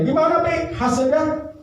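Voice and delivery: high, sweet wife asking; wife's voice. Acted. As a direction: ask like a curious wife in a high voice. (istri bertanya dengan suara tinggi)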